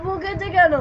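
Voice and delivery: high, loud indignant question; indignant protest. Real, not acted. Challenging the questioner over a scolding, indignant.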